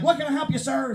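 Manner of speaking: high, eager service voice; over-eager new teller. Acted. New bank teller offering help, over-eager. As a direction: pitch it up like an overkeen rookie clerk.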